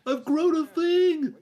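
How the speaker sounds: high, slow squeal of delight; excited Clarkson. Retold or imitated. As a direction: squeal it like a thrilled farmer. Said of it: "he's like".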